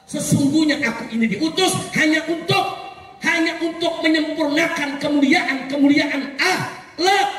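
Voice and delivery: sustained, ringing rally sermon; impassioned preaching. Real, not acted. Preacher telling a crowd why the Prophet came, impassioned. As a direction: drive each clause out to the crowd.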